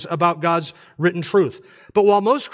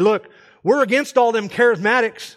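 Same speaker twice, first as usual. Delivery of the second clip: higher-pitched mock Southern preacher; Southern accent; 5 semitones higher than usual. Pastor addressing his Baptist church from up front, Southern accent. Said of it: "do that in a Southern accent".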